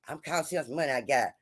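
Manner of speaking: low, quiet, fast mumble; mock cheap date. Cheap date checking his card, low fast mumble. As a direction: mumble it like a broke man hiding it.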